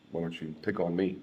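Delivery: ordinary delivery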